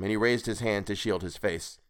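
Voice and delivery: ordinary delivery, nothing marked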